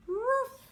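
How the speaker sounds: high, loud playful woof; loud mock puppy bark; dog bark. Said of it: "don't forget to bark".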